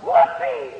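high mock holler of a party woman; hollered whoopee. Preacher mimics a drunk woman's party whoop. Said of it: "and hollered".

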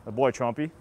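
ordinary talk

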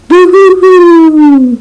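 high, slow hammed-up boo-hoo wail; boo-hoo sobbing. Hurt bird bawling to his friends, hammed-up high boo-hoo sob. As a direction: wail it like a cartoon crybaby. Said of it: "cried Bird".

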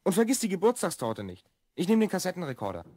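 ordinary talk

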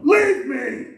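high, stern banishment; Jesus banishes the goats in a high, stern voice; Jesus dismissing. Acted. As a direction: push them away as Jesus. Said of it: "can you imagine Jesus, the Spirit of God, going".

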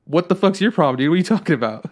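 indignant retort, retold. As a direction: act out throwing the challenge straight back. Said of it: "I was just like".

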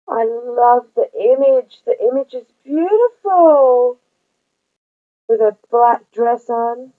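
light up at the photo; delighted gasp. Real, not acted. Thrilled at the card's picture, gushing. Gasps at friend's card photo.

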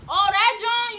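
high, loud "oh, that John"; exaggerated mock exclamation; mock excited. Mocking Philly usage.

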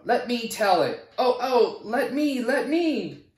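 high, eager voices begging to tell it; little characters crowding in, laughing; eager pleading characters. Acted. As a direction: beg to be the one who tells it, with a laugh.

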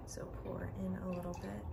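quick whisper; hurried whisper aside. Real, not acted. Making coffee quietly.

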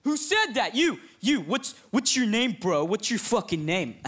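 pushy high school bully demand; aggressive jock. Acted. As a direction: get in his face. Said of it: "He's like".

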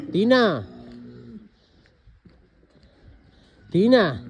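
loud, high call across the field. Real, not acted. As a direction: call the animal from a distance. Calling an animal from afar. (llamada fuerte y aguda)

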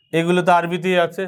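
usual speaking voice

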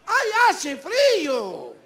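high, emphatic stretch on frío; drawn-out frío. Acted. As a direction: drag out frío for comic exaggeration. Exaggerates cold of highland towns.

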